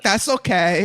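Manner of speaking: put-on Dominican girl. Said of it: "some Dominican girl".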